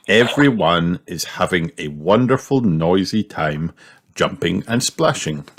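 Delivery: plain reading voice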